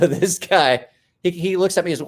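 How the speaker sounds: normal talking voice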